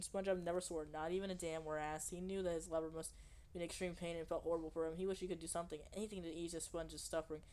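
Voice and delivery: ordinary delivery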